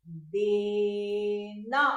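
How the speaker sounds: देना read very slowly, syllable by syllable; देना, drawn out, each sound clear. Shown as a demonstration. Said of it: "क्या लिखा".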